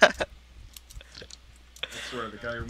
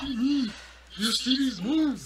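bragging; 9 semitones higher, 4 dB louder than usual. Acted.

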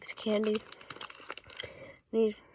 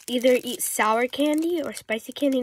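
normal talking voice

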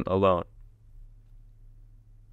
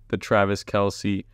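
ordinary delivery

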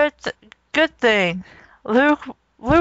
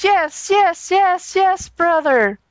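mock moaning; 7 semitones higher, slower than usual. Leia moaning in story.

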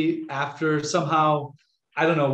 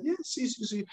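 meek reply; 8 semitones higher, 9 dB quieter than usual. Retold or imitated. Quotes own meek reply.

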